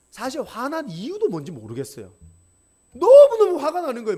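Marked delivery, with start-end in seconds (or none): 2.9-4.2 너무너무 burst out high and loud, as if the rage rises again; reliving anger. Retold or imitated. Preacher telling the young congregation his anger, loud outburst. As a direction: shout it as the fury comes back.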